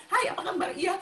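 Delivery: demonstrating own quick chatty manner; quick talk. Shown as a demonstration. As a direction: fire off a casual hello. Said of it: "cepet ya ngomongnya saya".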